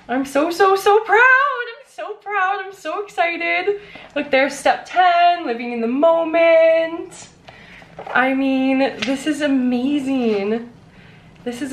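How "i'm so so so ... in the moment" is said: high, slow, overcome with emotion; gushing high and slow with pride; teary pride at her first copy; overjoyed. Real, not acted. Overwhelmed holding her book.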